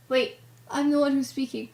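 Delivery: normal talking voice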